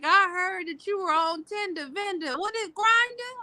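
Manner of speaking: high-pitched mock nosy relative. Retelling relatives' funny nosy question.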